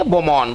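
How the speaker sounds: बोमोन as "bo-mon", falling; बोमोन, "bo" first, ending on n; correct French pronunciation. Shown as a demonstration. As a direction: answer with बोमोन, the final d dropped. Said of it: "इसको क्या पढ़ेंगे".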